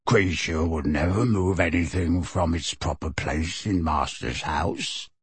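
low, slow servile elf. A narrator's reading. The elf answering Sirius, low and slow. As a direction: croak it out slowly like a bowing elf.

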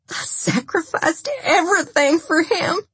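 high-pitched, tearful protest; anguished outburst. A narrator's reading. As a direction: cry out the hurt, voice way up. Lindsay breaks down, holding back tears.

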